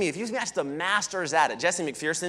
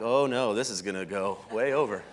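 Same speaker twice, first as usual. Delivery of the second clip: mock dread; 8 semitones lower, 4 dB quieter than usual. Acted inner dread at long story.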